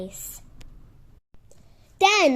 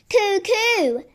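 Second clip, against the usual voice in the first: cuckoo call; 6 semitones higher than usual. Acted. Reggie calling down the chimney as a cuckoo. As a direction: sing out cuckoo like a bird.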